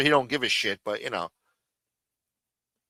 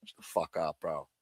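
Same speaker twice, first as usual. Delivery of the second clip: quiet shutdown; 8 semitones lower, 13 dB quieter than usual. Real, not acted. Fading dismissal.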